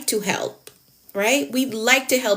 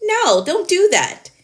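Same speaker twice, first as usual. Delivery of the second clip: high, louder scolding no; firm warning; 9 semitones higher, 4 dB louder than usual. Acted. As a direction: stop them sharply, like a firm parent. Scolding a rescuing habit.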